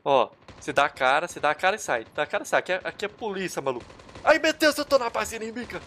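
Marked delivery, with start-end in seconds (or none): 4.2-5.8 high, loud yelp of alarm easing off; high-pitched gamer panic; panicked cry. Real, not acted. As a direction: blurt it out in sudden alarm. Alarm on landing in enemy base.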